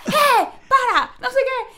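high, loud, slow shout. Retold or imitated. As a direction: shout to stop the stranger. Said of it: "mi exnovio grita". (el exnovio gritando para que se detenga)

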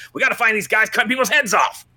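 high, strained movie cop outburst; gruff-cop impression. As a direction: play an older cop screaming at his underlings. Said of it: "He was the cop".

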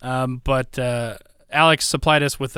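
ordinary delivery

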